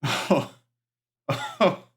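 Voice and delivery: very high, slow, breathy near-whisper; tearful high falsetto; high moved voice. Acted. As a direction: squeak it out, touched and choked up. NPC moved by Dorothy's praise.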